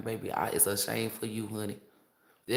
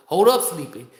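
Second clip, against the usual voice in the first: usual speaking voice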